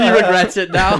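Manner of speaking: loud, high words through laughter; laughing tease. Real, not acted. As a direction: tease him while cracking up. Laughing at friend's regret.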